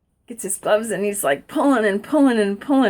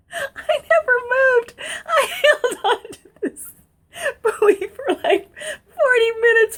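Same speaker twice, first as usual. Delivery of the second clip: laugh-crying; 7 semitones higher than usual. Real, not acted. Laughing tears through the tale.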